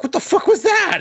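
very high, hammed-up outburst; imitating a genie's appalled reaction; mock outraged disbelief. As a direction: squawk it in comic indignation. Genie protests the wish.

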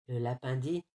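usual speaking voice

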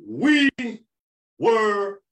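high, loud shout, one word at a time; triumphant shout. Acted. Host closing the Men of Honor podcast, triumphant shouted catchphrase.

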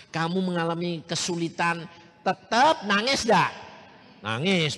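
usual speaking voice

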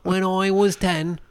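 high, slow put-on British accent; silly high British accent voice; mock British accent. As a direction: ham it up as a British fan. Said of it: "I don't know why he's British".

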